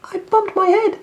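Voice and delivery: high, loud, grumpy little rabbit; sulky rabbit complaining from the well; cross little voice. Acted. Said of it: "the cross voice answered".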